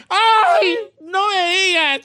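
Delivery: high, loud, drawn-out "¡Ay! No me digas"; hammed-up shocked lady; mock old lady gasp. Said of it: "Y me dijo".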